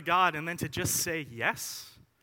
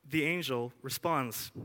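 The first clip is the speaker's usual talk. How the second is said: normal talking voice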